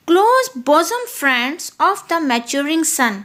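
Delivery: slow, measured verse reading; slow iambic recitation; poem recital. Shown as a demonstration. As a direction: read the line slowly, weighing each foot. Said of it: "उसको लंबा खींच के बोला जा रहा है".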